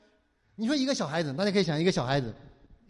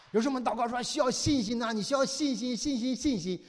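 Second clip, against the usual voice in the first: mock fretting; 4 semitones higher than usual. Mocking anxious faith-seeking.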